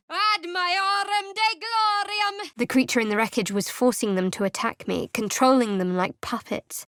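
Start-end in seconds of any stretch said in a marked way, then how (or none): 0.1-2.5 much higher, slow ringing chant; spell incantation. Acted. Cleric glorifying her goddess while casting, ringing chant. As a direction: intone it like a hymn to the goddess.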